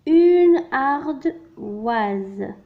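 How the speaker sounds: ardoise stretched far out; very slow, loud dictation. Shown as a demonstration. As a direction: dictate it to the class. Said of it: "Je complète les mots avec wa".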